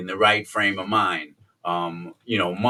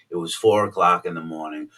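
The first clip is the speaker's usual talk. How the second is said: ordinary talk, nothing marked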